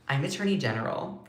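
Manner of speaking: a cold, quiet correction; softly delivered snippy retort; curt correction. Retold or imitated. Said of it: "She goes".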